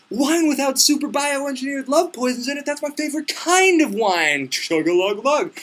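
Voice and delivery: high-pitched, over-the-top enthusiasm; eager mock bravado. Hero delighted by his favorite wine, hammed-up glee. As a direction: crow it high, stretching the end.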